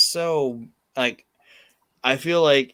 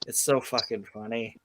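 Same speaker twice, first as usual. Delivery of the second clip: ordinary talk, nothing marked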